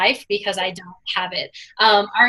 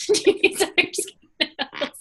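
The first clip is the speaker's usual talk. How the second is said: higher, talking through laughter; laughing tease; 7 semitones higher, 3 dB quieter than usual. Real, not acted. Teasing Valerie about haircut remorse, laughing.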